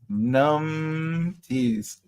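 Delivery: "Numpties" stretched out, lingering on the first syllable; savouring the word. Shown as a demonstration. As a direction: draw out "Numpties" slowly. Repeats the word, drawing it out.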